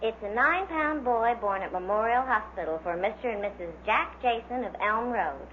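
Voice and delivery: usual speaking voice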